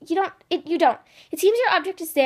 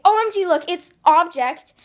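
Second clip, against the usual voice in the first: loud, high fangirl outburst; excited; 3 semitones higher, 8 dB louder than usual. Acted. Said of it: "Become excited and say".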